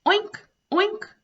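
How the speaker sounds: high, loud oinks; silly pig sound for kids; pig oinking. Shown as a demonstration. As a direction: oink like a little piglet. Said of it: "It's pink and goes".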